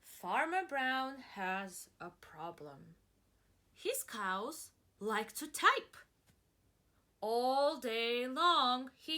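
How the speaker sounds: put-on reading to children. Teacher reading the story to the class, slow storytelling. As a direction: read it slowly and grandly to kids.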